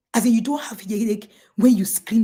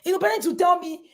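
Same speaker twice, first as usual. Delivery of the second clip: ordinary talk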